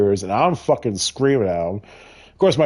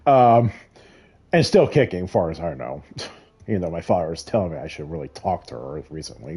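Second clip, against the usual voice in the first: normal talking voice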